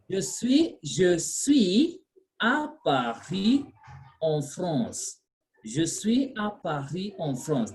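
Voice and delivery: slow French, nasal "en France"; "je suis à Paris, en France" said slowly, nasal en; French model reading. Shown as a demonstration. Teacher modelling the French sentence after a student's error, slow. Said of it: "Now listen".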